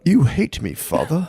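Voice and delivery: high mock made-up British lilt; lightly voiced, half-hearted imitation of his odd accent; mock Game of Thrones accent. Friend imitates an actor's odd accent, mock British lilt. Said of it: "What was his accent?".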